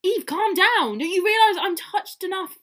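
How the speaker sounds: high, loud theatrical exasperation; exasperated plea. Acted. Oliver tells upset Eve to calm down, exasperated.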